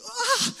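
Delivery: high, loud frightened yelp; startled scream. Retold or imitated. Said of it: "Und ich bin so erschrocken".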